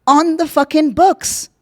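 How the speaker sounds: high, slow, punching fucking books; rally the crowd, every word pushed up; emphatic demand. Real, not acted. Urging viewers toward an arms embargo, high emphatic call.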